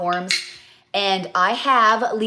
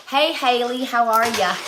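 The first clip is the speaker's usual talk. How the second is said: Southern accent; 5 semitones higher, 4 dB louder than usual. Shown as a demonstration. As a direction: stretch it out like a friendly Southern lady, a bit higher. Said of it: "They said it like this".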